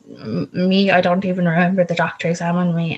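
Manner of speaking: ordinary talk, nothing marked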